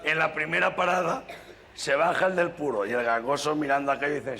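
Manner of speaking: ordinary talk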